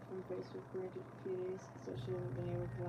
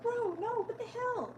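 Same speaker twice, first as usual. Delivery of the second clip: bro what the hell; 13 semitones higher, 4 dB louder, slower than usual. Retold or imitated. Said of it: "She's like".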